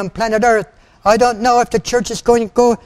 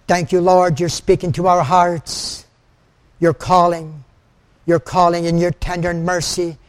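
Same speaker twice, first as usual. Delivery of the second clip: earnest prayer; 3 semitones lower than usual. Acted. Leads the closing prayer.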